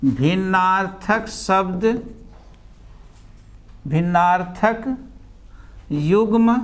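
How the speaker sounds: slow, drawn-out dictation pace, word by word; slow classroom dictation. Shown as a demonstration. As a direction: spell it out slowly so the class can write. Teacher dictating words to class.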